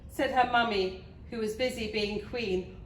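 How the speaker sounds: plain reading voice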